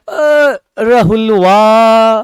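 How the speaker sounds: stretched calling out of a name; village long-distance call. Retold or imitated. Villagers calling Rahul home from far off, long drawn call. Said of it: "गांव वाले तो कहते हैं".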